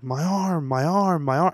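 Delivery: retold screaming. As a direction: replay the panicked cry for his arm. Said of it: "made me just scream over and over again".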